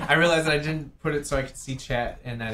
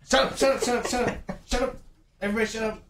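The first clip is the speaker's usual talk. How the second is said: high-pitched hush; 7 semitones higher, faster than usual. Real, not acted. Hushing the table to listen.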